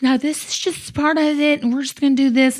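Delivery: a hint of a sweet student nurse calming her down; young nurse reassuring. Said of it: "She says".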